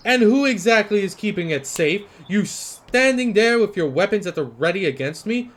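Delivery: loud, high, outraged merchant; indignant merchant. Acted. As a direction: hurl the question back at him. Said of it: "his arms swinging about again, emphasizing words".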